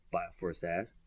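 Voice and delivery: usual speaking voice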